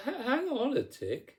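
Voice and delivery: high-pitched stammered stalling; high, flustered fairy voice. A narrator's reading. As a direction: squeak it out, stumbling on the first word. Flustered fairy answers Cindy's demands.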